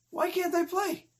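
high, whiny schoolboy plea; plaintive protest. Acted. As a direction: plead like a left-out child. Excluded boy pleads once more.

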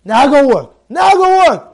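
acted angry boss, escalating; harsh order. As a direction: order a beaten worker off, forcefully. Cruel manager orders beaten worker.

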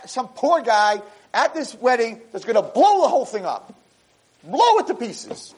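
high, building excitement over the ruin; rising drama. Acted. Preacher painting the wedding about to blow up, high and dramatic. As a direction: pitch it way up as it all falls apart.

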